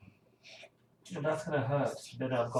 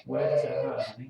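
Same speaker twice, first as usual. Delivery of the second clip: mock crying; 2 semitones higher, 9 dB louder than usual. Mock sympathy over hurt hand.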